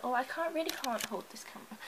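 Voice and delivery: ordinary delivery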